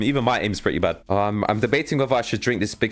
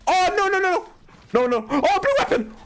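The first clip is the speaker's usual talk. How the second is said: high-pitched frantic squeal; flustered, very high outburst; excited panic. Real, not acted. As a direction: squeal as the wanted prize suddenly appears. Sudden find of blue weapon.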